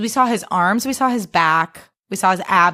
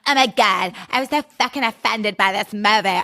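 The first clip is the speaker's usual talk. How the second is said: mocking whiny quote. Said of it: "people are like".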